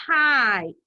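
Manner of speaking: "kite" with the long i stretched out; drawn-out long I. Shown as a demonstration. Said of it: "Did you hear the I in kite?".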